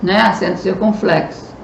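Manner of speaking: normal talking voice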